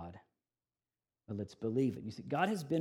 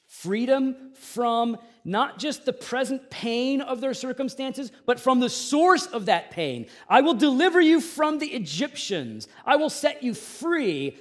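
preaching at full force; 11 semitones higher, 9 dB louder than usual. Acted. Pastor declaring God's plan to the congregation, loud preaching. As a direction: proclaim it to the whole room.

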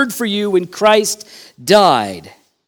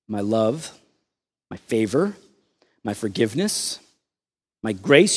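tender offer; 8 semitones lower, 7 dB quieter than usual. Pretend. Preacher voicing Jesus offering grace to the congregation, softly. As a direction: gently lay out each gift.

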